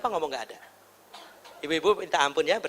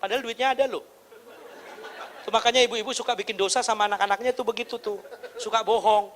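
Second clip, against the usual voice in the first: ordinary talk, nothing marked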